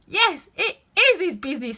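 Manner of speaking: loud high gardener voice; arguing. Acted. Five argues with Seven, loud and high. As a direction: insist back at him.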